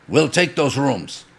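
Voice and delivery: mock Russian demand, slow and firm; Russian accent. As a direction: lean into a Russian tough-guy accent. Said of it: "these two big fucking Russian dudes".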